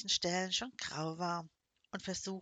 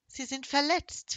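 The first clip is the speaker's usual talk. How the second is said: usual speaking voice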